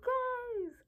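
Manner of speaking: high, thin squeal; overwhelmed fangirl squeal. Real, not acted. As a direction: squeal it, overcome by the book. Gushing over the couple's romance scene.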